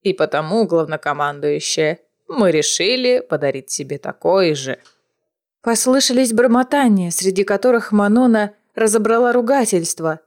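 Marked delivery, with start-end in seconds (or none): 0.0-4.8 grandmother voice. A narrator's reading. Grandmother addresses Manon.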